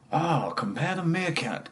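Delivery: advert-style Russian impression; mock Russian accent. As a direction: stretch it out in cartoon Russian. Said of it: "kind of Russian".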